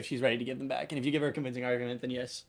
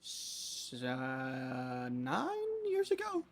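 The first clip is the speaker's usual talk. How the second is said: unsure cook; 8 dB quieter, much slower than usual. Acted. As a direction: stretch it out and squeak up into a question. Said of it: "when did you start working here?".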